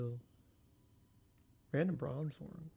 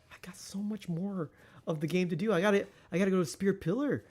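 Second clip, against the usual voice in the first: normal talking voice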